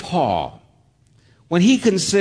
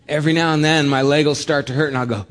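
ordinary delivery

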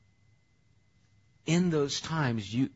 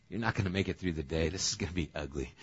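low, quick, understated reply; resigned aside; 6 semitones lower, 4 dB quieter, faster than usual. Acted. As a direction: predict failure in a low, rapid undertone. God's weary reply to the vow.

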